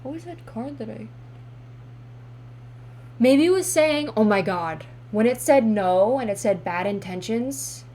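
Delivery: ordinary talk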